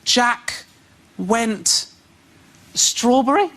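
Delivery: mock child stumbling through a reader; imitating son reading. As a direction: sound out each word like a confused kid. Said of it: "when my son goes".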